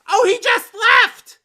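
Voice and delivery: indignant loud outburst; outraged shout. Acted. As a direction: yell after the waiter.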